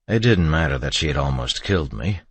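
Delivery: plain reading voice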